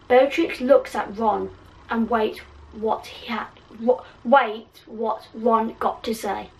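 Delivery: ordinary delivery, nothing marked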